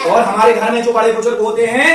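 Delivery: ordinary delivery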